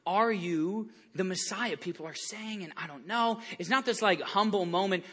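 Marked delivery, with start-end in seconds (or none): 0.0-3.4 hushed, unsure wondering; soft questioning. Retold or imitated. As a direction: murmur it like a doubter. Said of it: "where they're like".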